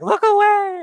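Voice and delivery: high, loud hammed-up wail; mock ugly cry. Crier warns friends off their ugly cry, mock wailing. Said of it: "if I cry, it's ugly".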